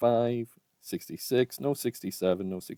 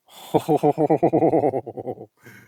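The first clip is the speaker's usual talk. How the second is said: loud laugh; 5 semitones higher, 12 dB louder than usual. Real, not acted. Coin hunter laughing at a great find, loud delighted laugh.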